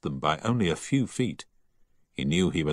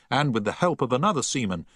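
ordinary delivery

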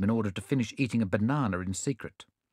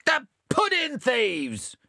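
high, slow accusing cry; crowd shout; 13 semitones higher, 3 dB louder, much slower than usual. A narrator's reading. The company denounces the thieves, high and slow. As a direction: cry out the discovery. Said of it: "shouted the company".

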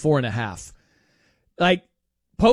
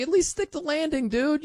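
egging him on; 9 semitones higher, 4 dB quieter than usual. Retold or imitated. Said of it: "You're like".

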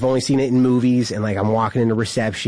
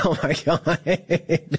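laughing disbelief; 3 semitones higher, much slower than usual. Retold or imitated. Reacting to celly's joke.

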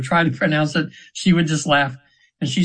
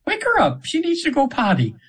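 much higher, sing-song angel voice; the angel's voice; 11 semitones higher than usual. Retold or imitated. Angel telling the healer the client needs the bathroom, in a very high sweet voice. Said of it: "I'm almost using her voice now".

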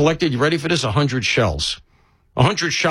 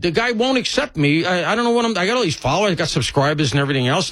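ordinary talk

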